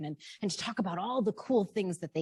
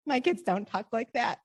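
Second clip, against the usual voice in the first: usual speaking voice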